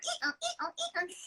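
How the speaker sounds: high mock sex moans, imitated; squeaky imitation of the film's moaning. Telling the cohost about the film's sex scene, mock moaning. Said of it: "it's going like".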